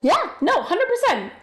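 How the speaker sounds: high, eager yes-no; strongly agreeing with chat, pitched high; emphatic agreement. Real, not acted. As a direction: agree with total enthusiasm.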